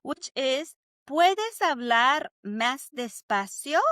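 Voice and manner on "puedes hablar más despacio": spoken slowly for students to repeat; slow Spanish model. Shown as a demonstration. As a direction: say each word slowly and clearly. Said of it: "Say it with me".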